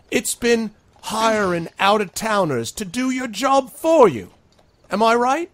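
high, loud demagogue working up a crowd; loud, high rally-speech voice; rabble-rousing speech. Acted. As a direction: stir the mob against the mayor.

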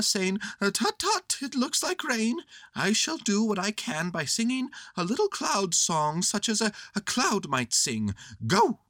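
high, busy bear voice; Winnie the Pooh. Acted. Bear directing boy's act, high instructing voice. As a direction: give orders in a high bear voice.

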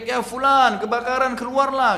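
high, loud alarmed call; imitated shouting. Neighbours yell to the man that his house is burning, shouting. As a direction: call out urgently, acting the neighbours. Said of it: "Tetangganya semua teriak".

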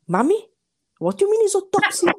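retold disbelief, rising high and loud; acted-out incredulous daughter; baffled question. Retelling her puzzled reaction to mother.

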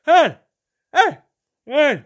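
loud, high one-syllable bursts; short shouted noise. Shown as a demonstration. Showing how Fran sounds like a noise, loud bursts. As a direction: bark the syllable out as a bare noise. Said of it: "It's a noise, isn't it?".

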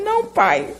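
high, tearful little answer; choked-up no to God; crying reply. Retold or imitated. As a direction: answer the father through tears, voice lifted high. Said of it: "eu já chorando".

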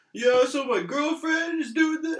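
imitating a phony radio caller; fake radio caller. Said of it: "having that fake call in".

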